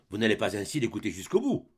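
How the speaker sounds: ordinary talk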